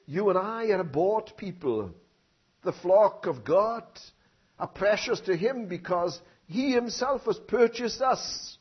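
slow, weighty pulpit emphasis; deliberate sermon voice; slow preaching. Acted. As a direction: lay each word down.

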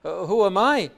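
Paul wrestling with his unworthiness; a preacher voicing Paul's anguish. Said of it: "says Paul".

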